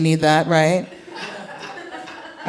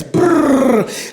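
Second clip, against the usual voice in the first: normal talking voice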